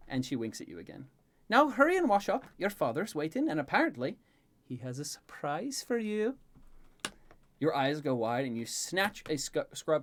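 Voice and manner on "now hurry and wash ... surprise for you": high, lilting character voice; brisk, teasing Elaine. Acted. Elaine telling of father's surprise, brisk and teasing. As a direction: hurry him, then dangle the surprise.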